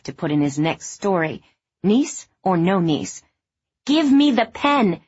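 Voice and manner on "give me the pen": loud, high, slow demand; shouting. A narrator's reading. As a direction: shout it over the noise. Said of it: "she shouted".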